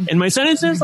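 "sentences" rising at the end like a question; questioning rise on "in my sentences"; uptalk. Shown as a demonstration. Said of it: "like when I talk like this".

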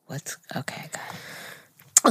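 hushed, under the breath before starting; nervous whisper. Real, not acted.

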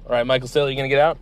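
normal talking voice

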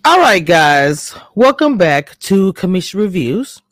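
loud, slow, drawn-out greeting; hyped intro. Shown as a demonstration. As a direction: belt out the welcome, slowly. Intro redone on the new mic.

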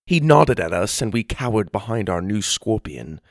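plain reading voice

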